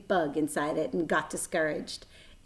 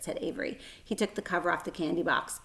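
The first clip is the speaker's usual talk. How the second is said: plain reading voice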